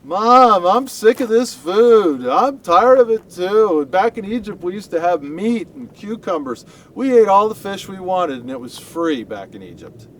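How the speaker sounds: put-on high, loud childish whine; whining complainers. Israelites to Moses, whining about food like kids. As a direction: whine like a kid sick of dinner. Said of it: "everybody started whining and complaining".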